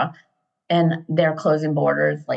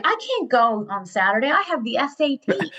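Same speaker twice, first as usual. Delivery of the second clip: put-on high-pitched teenage objection; imitated protesting daughter; 7 semitones higher than usual. Daughter objecting to leaving before her SAT, imitated protest. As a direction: push the pitch up in mock protest.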